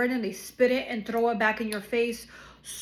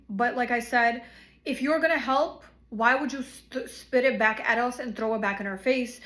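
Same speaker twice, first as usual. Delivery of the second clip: ordinary talk, nothing marked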